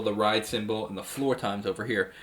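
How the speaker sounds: ordinary delivery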